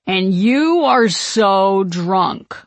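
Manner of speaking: loud, very drawn-out tease; slow, loud exasperated grunt; grunted reproach. A narrator's reading. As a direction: scold a drunk friend, stretching the words. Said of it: "one friend grunts at him".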